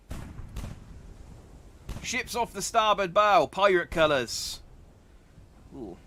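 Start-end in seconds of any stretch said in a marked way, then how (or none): 2.0-4.6 lookout call. Acted. Lookout calls out pirates.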